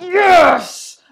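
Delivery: loud, high raw cheer; involuntary roar. Retold or imitated. Fan re-enacting her reaction to penalties, involuntary roar. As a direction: let out a primal celebratory yell. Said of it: "noises that came out of me".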